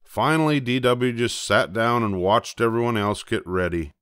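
ordinary delivery

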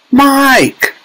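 high-pitched mother's call; anxious cry. A narrator's reading. Mother spots her son on screen, high cry. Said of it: "cried Missus Teavee".

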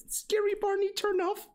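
very high squeaky command; high silly squeak; squeaky mock voice. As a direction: pipe it up like a cartoon.

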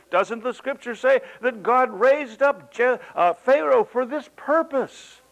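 high, loud, slow challenge; Paul answering his critics, emphatic and loud; emphatic rebuttal. Retold or imitated. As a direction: press the point hard. Voicing Paul's rebuttal.

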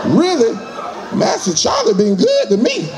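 lightly voiced old slave, half laughing, with a whispered "Shucks"; old freed slave. Interviewed freed slave explaining why he won't leave, lightly voiced and chuckling. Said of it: "They're like".

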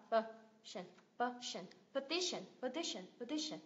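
"Petition" with soft, reduced outer syllables; schwa syllables. Shown as a demonstration. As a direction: swallow the edges of "Petition" to uh. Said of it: "Hai âm xoa sao".

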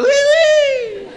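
very high, drawn-out "oui"; mock French squeal.

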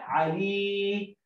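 very slow علي, long level ending; syllable sounding-out. Shown as a demonstration. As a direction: draw out the final ii of علي on a held note. (نطق علي ببطء مع مد الياء)

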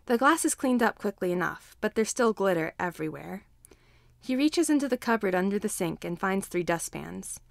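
usual speaking voice

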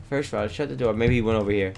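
ordinary talk

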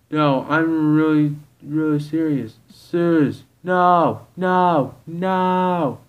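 loud, high, emphatic refusal; insistent protest. Acted. Insistent protest in acted argument.

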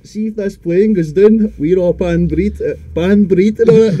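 retold drunken proclamation of doom; loud doom warning. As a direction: warn the whole plane, loudly and slowly.

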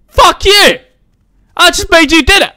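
high, loud outraged squeal; mock angry shriek. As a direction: yell it like a hammed-up spurned husband. Mock quarrel with game wife.

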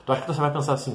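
ordinary delivery

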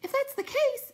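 very high, loud cry; high excited cry. A narrator's reading. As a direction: leap up in alarm crying out. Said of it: "it cried, jumping up".

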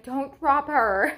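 high, drawn-out mock warning; retold nervous warning. Mother warning partner holding baby one-armed, mock panic. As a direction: stretch out a playful panicked plea.

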